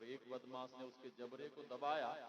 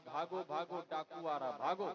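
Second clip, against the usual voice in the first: loud, raised-pitch acted warning; storyteller acting panicked villagers; alarmed calling out; 4 semitones higher, 8 dB louder than usual. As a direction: cry out for everyone to flee. Acted villagers fleeing bandits, calling out.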